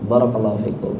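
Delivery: ordinary talk, nothing marked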